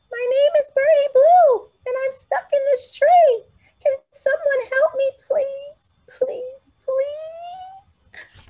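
very high, tiny pleading bird voice, near tears; a little stuck bird begging for help; high, weepy little bird pleading; small bird voice. Acted. Birdie Blue pleading, first time.

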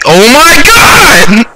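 high, drawn-out scream of alarm; frightened yell. Real, not acted. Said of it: "Sorry, I'm yelling".

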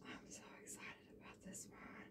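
very soft, voiceless whisper; excited whisper. Real, not acted. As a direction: whisper it, thrilled about the new palette. Whispers her excitement over the palette.

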